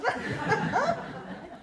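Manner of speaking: high-pitched giggling cackle. Real, not acted. Old veteran cracking up at his own punchline, high cackle. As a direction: crack up at your own line. Laughs after the Pa's house joke.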